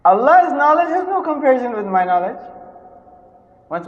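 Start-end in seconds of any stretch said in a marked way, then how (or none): 0.1-2.4 high, loud proclamation; preaching climax. Real, not acted. Preacher proclaiming Allah's knowledge, loud and high. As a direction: declare it with full force.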